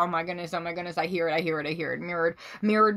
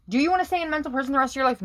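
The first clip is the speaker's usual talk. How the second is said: much higher and fast; rhetorical challenge; 8 semitones higher, much faster than usual. Real, not acted. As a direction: fire the question at them. Challenging the viewer.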